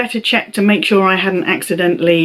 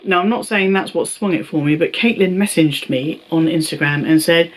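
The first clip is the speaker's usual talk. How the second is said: usual speaking voice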